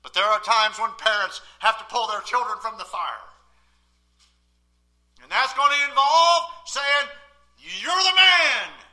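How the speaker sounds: loud pulpit preaching, rising high; full-force preaching. Acted. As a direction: preach it out to the whole church.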